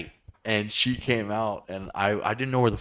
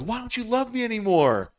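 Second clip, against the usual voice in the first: mock pleading ex; 13 semitones higher than usual. Retelling the ex's plea.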